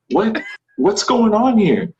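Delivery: high, slow, baffled "what's going on"; confused surprise. Retold or imitated. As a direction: sound lost and caught off guard. Confused on set.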